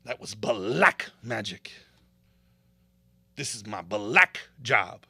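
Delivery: slow mocking imitation of how they talk; mocking impression. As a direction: mimic the way they say it. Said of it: "these folks say".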